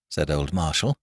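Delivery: plain reading voice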